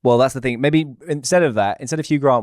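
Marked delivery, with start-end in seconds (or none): none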